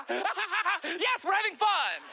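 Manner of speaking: high, loud, strained cheer; forced enthusiasm. Retold or imitated. Imitating Tom Cruise awkwardly showing fun, loud high cheer. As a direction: shout the fun like a robot trying to fit in. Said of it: "Tom laughs like this".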